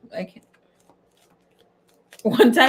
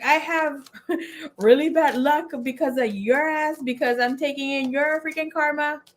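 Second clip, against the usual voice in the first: higher, amused imitation of blame; playful higher-pitched blaming; mock brotherly complaint; 7 semitones higher than usual. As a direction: complain like an aggrieved brother, laughing midway. Retold brother blaming her, amused.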